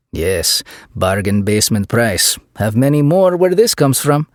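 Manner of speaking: lightly voiced thick Eastern European accent; a hint of Eastern European accent. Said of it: "in a thick Eastern European accent".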